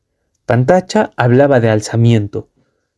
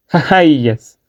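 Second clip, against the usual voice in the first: higher jeering laugh-word; mocking laugh; 4 semitones higher than usual. A narrator's reading. Bugler jeers at don Vilcas, mocking laugh. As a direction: laugh in the old man's face. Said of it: "Pantacha se rió fuerte".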